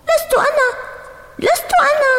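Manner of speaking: put-on very high, indignant little boy; angry child protest. A narrator's reading. Brother Zaid falsely blamed, high indignant protest. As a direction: protest your innocence hotly. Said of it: "صاح زيد غاضبا".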